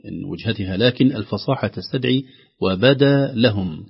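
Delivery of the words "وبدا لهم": وبدا لهم slowly, a clear break between words; each word separate. Shown as a demonstration. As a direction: set وبدا apart from لهم. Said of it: "كلمة بدا كلمة مستقلة". (وبدا لهم كلمتان مستقلتان)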